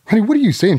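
high, loud, baffled honey; you can't believe what she just said; husband on speakerphone, in high, loud disbelief. Retold or imitated. Husband baffled by wife's wording.

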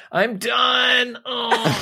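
high, drawn-out straining shout; hammed-up, effortful impression of oneself; strained self-impression. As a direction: groan it out like straining on the toilet. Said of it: "do an impression to me? It sounds like I'm pooping".

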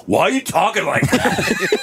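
high, drawn-out mock disbelief; stretched, high-pitched retort through amusement; Caleb. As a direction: imitate a friend bluntly blurting it out. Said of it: "Caleb was like".

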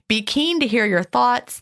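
ordinary delivery

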